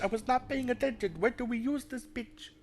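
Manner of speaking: high-pitched mock accent, part Indian, part Spanish; muddled mixed accent. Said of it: "I don't know why I'm doing an accent".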